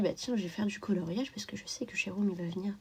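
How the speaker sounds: breathy hushed whisper. Real, not acted. Whispering to the stream about coloring for Jérôme. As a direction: lean in and whisper it.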